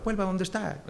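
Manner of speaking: a light Galician sing-song; Galician accent. Retold or imitated. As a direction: mimic a puzzled Galician. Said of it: "hablaban así porque eran gallegos".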